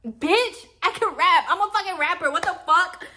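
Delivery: high, loud and giddy; brag, thrilled with yourself; hyped up. Real, not acted. Bragging to viewers that she can rap, high and giddy.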